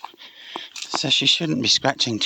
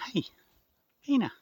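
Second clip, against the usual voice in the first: soft greeting; 12 semitones higher, 4 dB quieter, faster than usual. Real, not acted. Greeting the cat softly.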